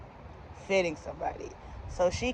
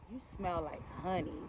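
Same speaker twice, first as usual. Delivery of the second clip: very soft imitation of a flirty customer; hushed flirting; 12 dB quieter than usual. Customer flirts with her fitter, hushed and suggestive.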